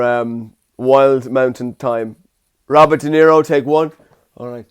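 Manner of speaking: usual speaking voice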